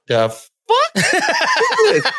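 very high falsetto yelp of disbelief; comic shock. Retold or imitated. Man reacting to substantial blood, exaggerated high-pitched shock. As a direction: squeak it out, hammed-up alarm.

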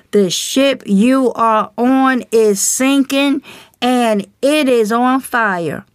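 ordinary talk

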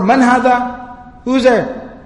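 calling through a door. Retold or imitated. Abu Jahl answers from behind the door, calling out. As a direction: call out to whoever knocked.

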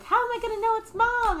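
put-on high fast childlike worry; little Mumble. Baby penguin Mumble asking his father. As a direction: ask like an anxious little chick. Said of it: "Mumble's like".

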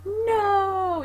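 high shouted no; cry of no. Retold or imitated. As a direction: yell it as you run in. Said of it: "Jesper's like".

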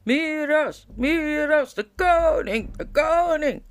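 loud, high roar of a chanting army; roaring crowd. A narrator's reading. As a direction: bellow the name like a whole army. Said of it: "klonk het gebrul".

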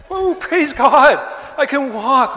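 high, loud, slow cry of praise; loud astonished praise, high; joyful praise. Acted. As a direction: cry out amazed, stretching the words. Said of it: "He's like".

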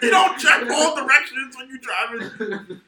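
high, loud, drawn-out disbelief; going ballistic. Retold or imitated. Son confronting mom about her driving, going ballistic. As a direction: shout the accusation way up high. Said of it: "I went ballistic on you".

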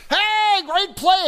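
high, loud celebrating shout; whooping sports-crowd excitement; cheering stadium fan. Acted. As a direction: shout to strangers in the stands after a big play.

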